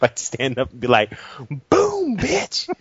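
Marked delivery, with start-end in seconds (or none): 1.7-2.7 high, taunting victory call; mock gloating. Kid standing up to gloat, high taunting crow. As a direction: crow it over the loser. Gloating after sinking a ship.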